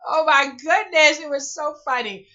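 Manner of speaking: laughing out high and loud; cracking up; loud laughter. Real, not acted. Laughing at own joke.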